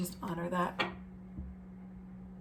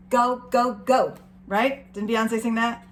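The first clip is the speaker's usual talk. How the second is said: usual speaking voice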